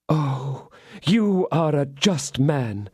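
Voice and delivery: slow, high gratitude; grateful cry. Acted. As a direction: fall at his feet in thanks. Milady grateful.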